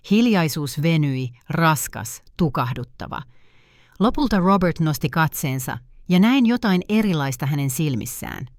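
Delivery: ordinary delivery, nothing marked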